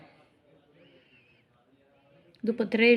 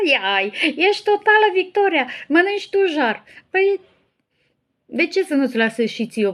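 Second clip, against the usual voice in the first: excited teasing; 8 semitones higher, 9 dB louder than usual. Real, not acted. Excited teasing reply amid laughter.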